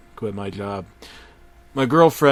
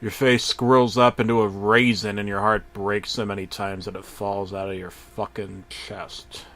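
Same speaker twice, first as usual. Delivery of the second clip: ordinary delivery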